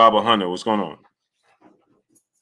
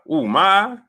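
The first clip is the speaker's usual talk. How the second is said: much higher put-on voice, puzzled then breezy; imitating Errol; 9 semitones higher than usual. Retelling Errol's answer about his eye, high mock voice. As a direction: play Errol shrugging off the eye question. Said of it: "He said".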